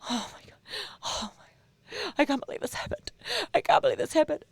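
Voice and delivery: hammed-up tearful disbelief, hushed and breaking off; fake crying. Acting out Kevin Costner's cry scene in the stables, mock weeping. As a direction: act a badly faked cry, very softly. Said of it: "Whenever he's doing a cry scene, I'll act one out for you".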